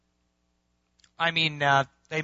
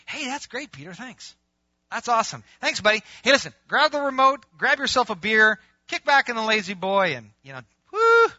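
high, hearty buddy talk building louder; chummy mock Jesus; 6 semitones higher, 8 dB louder than usual. Parody of Jesus thanking Peter, chummy and hearty. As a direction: cheer him on to relax, ending in a whoop.

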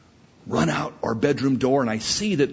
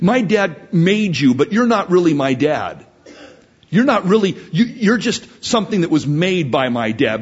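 loud, higher-pitched acted denial; protesting son; 4 semitones higher, 6 dB louder than usual. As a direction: play a child insistently disowning dad. Acted son rejecting his father.

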